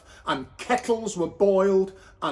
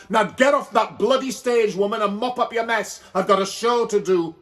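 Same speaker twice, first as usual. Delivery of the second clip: louder, fast scolding; bossy landlady; 4 semitones higher, 5 dB louder, faster than usual. Acted. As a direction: shoo her off the stage. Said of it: "landlady ordering her off stage".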